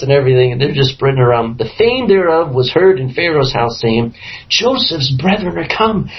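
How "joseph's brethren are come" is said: excited gossip. Acted. Palace gossip announcement.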